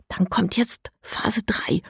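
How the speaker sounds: slow conspiratorial whisper. A narrator's reading. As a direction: whisper the plan slowly. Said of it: "sagt die erste Stimme". (langsames Verschwörerflüstern)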